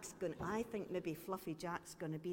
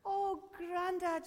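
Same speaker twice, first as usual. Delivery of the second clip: high, loud, drawn-out greeting; delighted cry; 11 semitones higher, 6 dB louder, slower than usual. A narrator's reading. Granddaughter sees her beloved grandfather, delighted high cry. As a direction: cry out in delight at seeing them. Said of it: "she cried".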